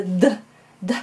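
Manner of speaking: d as a short voiced duh, said twice; d, d tapped with voice, a gap between. Shown as a demonstration. Teacher showing the alveolar stop, d with voice, repeated. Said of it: "which is voiced".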